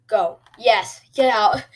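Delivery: loud, short urging bursts; urgent cheering. Real, not acted. Gamer urging his runner on mid-play, loud cheering.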